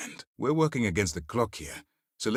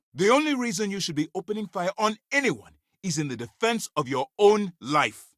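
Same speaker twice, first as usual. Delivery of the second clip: high, raised-voice reprimand; angry rebuke; 10 semitones higher than usual. Acted. Officer scolding an agent over opening fire, angry rebuke. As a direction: lay down the rule, pitched way up.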